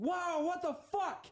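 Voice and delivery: high, slow startled cry of shock; startled yell. Retold or imitated. Yelling at a spider dangling at his face, startled. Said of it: "I just start screaming on the phone".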